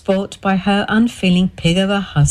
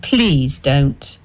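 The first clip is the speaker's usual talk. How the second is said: ordinary delivery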